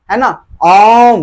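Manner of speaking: ओम with a long held ओ; drawn-out vowel. Shown as a demonstration. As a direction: stretch the ओ in ओम slowly. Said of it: "जिसको लंबा खींचा जाए".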